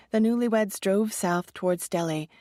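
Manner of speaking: ordinary delivery, nothing marked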